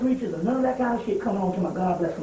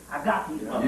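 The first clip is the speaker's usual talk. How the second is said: low, quieter smug mutter; a lightly voiced cocky president; low and quieter, pleased with himself; 10 semitones lower, 4 dB quieter, faster than usual. President quoted looking at Bible.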